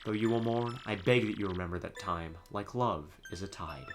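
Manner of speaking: soft, solemn farewell; tender letter reading. Acted. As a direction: read a last love letter gently, with pauses. Reads dead wife's letter aloud.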